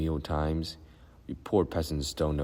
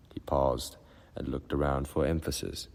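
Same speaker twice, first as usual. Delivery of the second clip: plain reading voice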